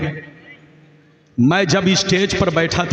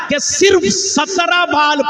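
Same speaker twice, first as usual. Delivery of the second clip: rousing preacher's cry; emphatic shout; 8 semitones higher than usual. Acted. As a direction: punch सिर्फ hard to the crowd. Preacher stressing a marvel.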